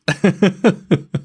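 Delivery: real reactive giggling; surprised laugh. Real, not acted. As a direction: crack up in disbelief. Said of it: "I'm laughing because I'm just like".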